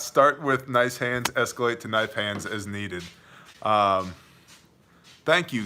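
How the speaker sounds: usual speaking voice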